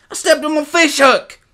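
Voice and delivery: put-on very high, loud boy's wail; pained wail. A narrator's reading. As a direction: cry out hurt and frightened. Hurt boy cries out fleeing bear.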